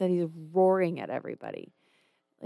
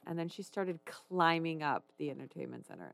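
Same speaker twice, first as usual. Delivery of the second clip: ordinary talk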